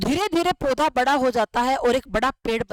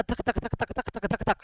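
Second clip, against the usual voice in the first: quick, soft, rhythmic thumping; rapid-fire heartbeat noise under the voice; imitated fast heartbeat; 3 semitones lower, 6 dB quieter than usual. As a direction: tap out a baby's racing heartbeat softly. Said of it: "वो कैसे धड़केगा".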